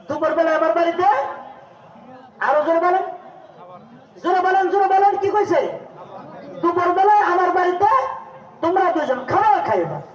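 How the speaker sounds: high, ringing sermon chant; high-pitched call to the crowd; rousing preaching. Acted. Rousing the congregation while preaching.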